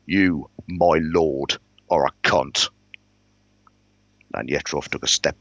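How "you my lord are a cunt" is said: slow, deliberate insult; measured, pointed contempt; confidential insult. Acted. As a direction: spell out the insult word by word, for his ears only. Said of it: "leaned close enough that I knew no one else could have heard us".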